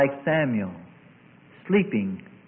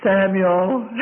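higher, louder summons; acted insistent call of a name; 6 semitones higher, 8 dB louder than usual. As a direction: call out to wake a sleeper. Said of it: "a voice that said unto him".

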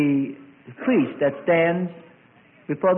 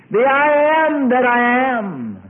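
loud, high and slow; grand proclamation; 10 semitones higher, 7 dB louder, much slower than usual. Acted. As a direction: draw out each word with awe. Preacher proclaims God's name.

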